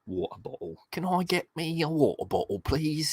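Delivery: lighter mock Cockney. Shown as a demonstration. Repeating Cockney bit.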